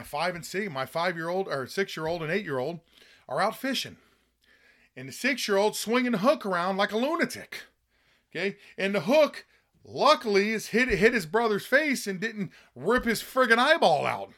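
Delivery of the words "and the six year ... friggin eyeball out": rising pitch, louder as the story builds; excited story climbing higher and louder; worked-up retelling. Retold or imitated. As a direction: get heated as the hook flies toward his brother's face. Retelling son's dangerous hook swinging.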